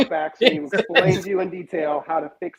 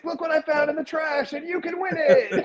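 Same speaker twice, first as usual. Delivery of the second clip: mock YouTuber host; 10 semitones higher, 4 dB quieter than usual. Mocking dumpster giveaway host.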